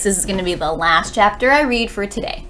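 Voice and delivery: ordinary delivery, nothing marked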